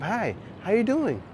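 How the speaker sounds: high-pitched put-on coyness; mock dating-app flirt. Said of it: "meet you on a dating app".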